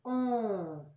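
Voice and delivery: a soft held nasal um for the tilde sound; nasal sound demo. Shown as a demonstration. Teacher models the nasal sound for pupils, softly. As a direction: say um as the nasal vowel the tilde makes. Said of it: "passa a ser".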